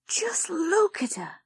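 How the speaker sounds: unvoiced whisper; whispered thought. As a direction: hiss it inwardly. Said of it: "Lizzie thought".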